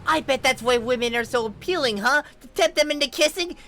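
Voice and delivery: high, loud, building to a scream; ranting at the snake, high and loud, building up; furious rant. Acted.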